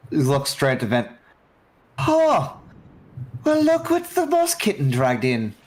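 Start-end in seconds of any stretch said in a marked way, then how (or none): 2.0-5.5 put-on high, breezy, teasing greeting to an old friend; high-voiced captain greeting an old comrade; captain NPC voice. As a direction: pitch it way up and purr the tease.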